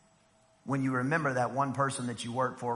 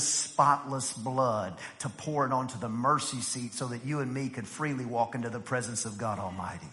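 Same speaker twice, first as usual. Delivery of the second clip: usual speaking voice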